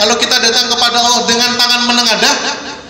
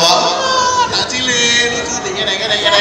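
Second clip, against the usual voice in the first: put-on cooing at a baby; 2 semitones higher, slower than usual. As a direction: coo over a little grandchild like a doting grandma. Cooing over grandchild. (nenek yang gemas menimang cucu, suara mendayu)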